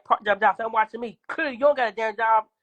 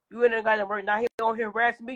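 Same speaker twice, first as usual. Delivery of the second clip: ordinary talk, nothing marked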